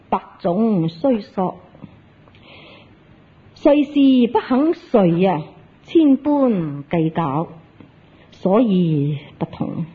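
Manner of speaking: slow, drawn-out scriptural quote; solemn recitation. Acted. Zen master answering the disciple's question, slow solemn recitation. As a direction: recite it slowly, phrase by phrase.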